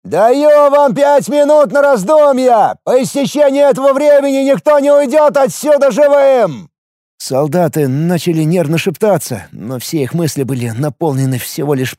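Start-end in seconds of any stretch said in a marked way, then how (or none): 0.0-6.7 shouted ultimatum. A narrator's reading. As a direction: yell the ultimatum from the wall. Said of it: "Вновь прогремел голос со стены".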